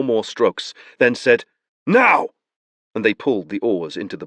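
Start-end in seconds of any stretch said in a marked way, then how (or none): 1.9-2.3 sharp command. Acted. Beron's command.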